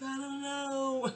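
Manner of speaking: high, long drawn-out mock wail; retold tearful wail. Sister answers her brother about staying put, retold tearful wail. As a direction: whine it out, stretching the words. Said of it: "And I cried".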